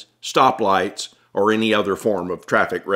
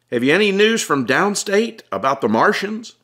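higher, urgent voice pressing for news; Tom, anxious question; 10 semitones higher than usual. Acted. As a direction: ask with worried urgency. Tom anxiously asks cop.

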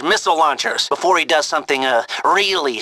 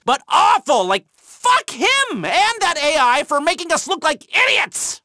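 higher, fired-up cursing; indignant rant; 10 semitones higher than usual. Acted. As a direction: vent the betrayal, fully worked up. Ranting about being fooled.

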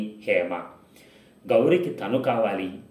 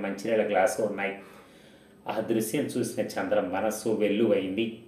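ordinary delivery, nothing marked

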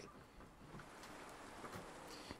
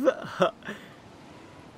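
laughing; 7 semitones higher than usual. Real, not acted. Laugh after [name] line.

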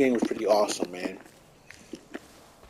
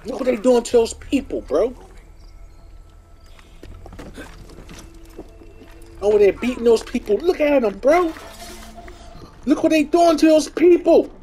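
shocked outcry; 10 semitones higher than usual. Real, not acted. Shocked at people being beaten.

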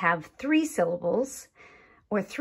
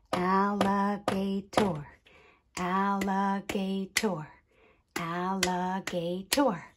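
"Alligator" in four separated beats; tapped syllables; 3 dB quieter than usual. Shown as a demonstration. Teacher showing sound groups, "Alligator" syllable by syllable. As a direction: say "Alligator" as al, li, ga, tor. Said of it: "tap out the different sound groups".

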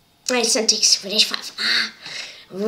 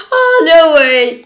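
loud, incredulous outburst; can't believe it; disbelief; 5 semitones higher, 15 dB louder than usual. Real, not acted. Disbelief at the blunder.

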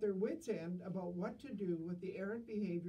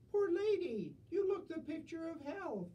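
much higher, louder put-on pitying voice; priest's sympathy; 10 semitones higher, 4 dB louder than usual. Priest consoling the deaf lady, high pitying voice. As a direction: coo over her in a high put-on voice.

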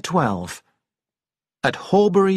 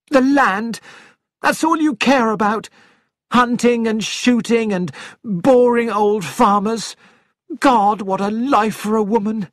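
furious wife; 6 semitones higher than usual. Acted. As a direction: spit out hatred at him. Wife scorns husband.

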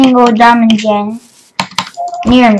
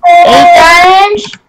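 disbelief; 4 semitones higher, much slower than usual. Real, not acted. Finding fewer diamonds than expected.

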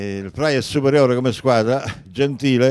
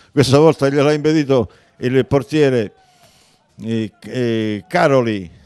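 normal talking voice

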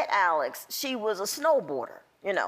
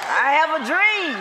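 Doctor King's speech; 2 semitones higher, 7 dB louder than usual. Retold or imitated. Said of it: "I heard Doctor King's speech".

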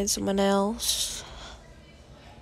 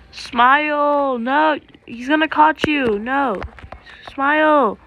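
loud, raised-pitch warning cry; alarmed calling; 4 semitones higher, 5 dB louder, slower than usual. Real, not acted. Player warning teammate about being caught, alarmed shouting. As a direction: call out to save your friend.

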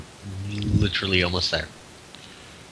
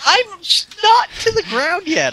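high whiny protest; 5 dB louder than usual. Pretend. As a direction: whine like a stubborn kid. Whiny refusal.

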